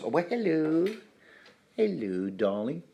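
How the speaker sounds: high-pitched hello to a pet; cooing pet greeting. Real, not acted. Podcaster greeting the cat just let in, cooing.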